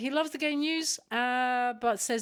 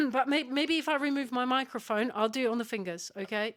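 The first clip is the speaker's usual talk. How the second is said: ordinary talk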